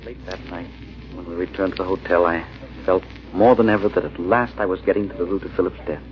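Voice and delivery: hushed, unhurried storytelling; story narration. A narrator's reading. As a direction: draw the listener into the dream. Narrator bridges into dream.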